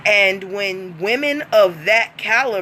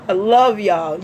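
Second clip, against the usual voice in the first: ordinary delivery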